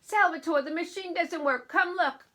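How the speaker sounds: loud, high call to husband. Acted. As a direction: shout for help with the broken machine. Wife calls for husband.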